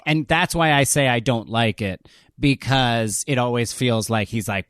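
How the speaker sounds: ordinary delivery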